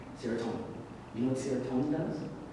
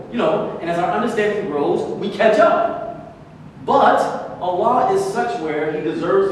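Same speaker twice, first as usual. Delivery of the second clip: louder, higher preaching at full force; fired-up sermon voice; impassioned preaching; 6 semitones higher, 8 dB louder than usual. Acted. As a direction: drive the point home from the pulpit. Preaching builds loud.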